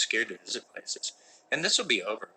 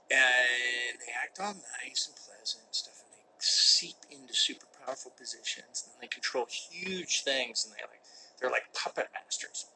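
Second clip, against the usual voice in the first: conspiratorial whisper. Pretend. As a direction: lean in and spill a dark secret. Conspiracy joke about Canadians.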